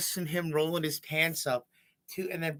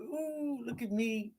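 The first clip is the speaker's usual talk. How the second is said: high, drawn-out prancing "ooh"; playful high-pitched mimicry without extra volume; mock ballerina; 10 semitones higher, slower than usual. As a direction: imitate a classmate showing off, pitched way up. Imitating classmate prancing as a ballerina.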